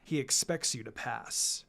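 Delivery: a calm assassin laying down the law to his students; stern sensei Kakashi. Acted. Kakashi continues briefing.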